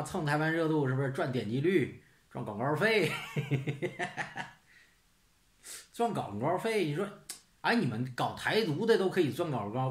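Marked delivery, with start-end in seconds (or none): 3.2-4.5 breathy snicker. Real, not acted. Laughs at ad revenue idea.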